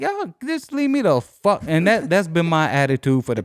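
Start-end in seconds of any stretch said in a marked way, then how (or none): 0.0-1.6 high, exasperated cry; fed-up outburst. Retold or imitated. Telling a friend he's done with the billers, high exasperated squeal. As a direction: throw up your hands and squeal it.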